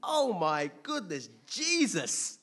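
loud high elated exclaim, retold; ecstatic cry. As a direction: shout it like he just met Jesus. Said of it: "And I'm like".

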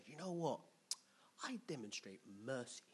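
soft whispered declaration; hushed, deliberate whisper; pretend merciful whisper. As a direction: whisper it as a show of restraint.

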